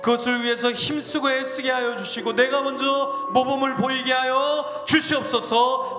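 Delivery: 점점 고조되는 통성기도; building fervent prayer. Acted. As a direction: pour it out to God, rising to a full-force plea. Prayer building to full force.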